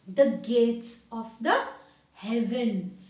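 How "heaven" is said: ordinary delivery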